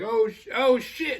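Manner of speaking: high, loud panicked cry of oh shit; panicked yelp. Retold or imitated. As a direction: yelp it in a panic as the flames catch. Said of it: "he panics so much".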